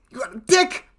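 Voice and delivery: high mock shriek. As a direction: shriek it in disbelief. Shrieked repeat of chat.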